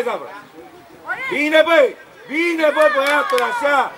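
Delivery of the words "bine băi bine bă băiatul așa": high, loud praise across the pitch; cheering shout. Real, not acted. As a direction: shout approval to the player. Cheering good play. (strigăt de laudă)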